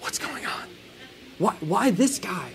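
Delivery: confused onlookers whispering to each other; crowd muttering. Retold or imitated. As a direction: mutter it under your breath, puzzled. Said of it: "the crowd starts to mutter".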